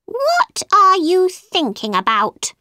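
high, cross mouse question; angry mouse. A narrator's reading. Mouse to distracted Alice, angry high voice. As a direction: demand an answer, high and annoyed. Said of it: "he said angrily".

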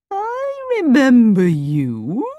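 drawn-out mild surprise from the Sultan. A narrator's reading. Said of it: "said the Sultan in surprise".

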